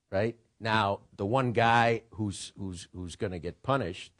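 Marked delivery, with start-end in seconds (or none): none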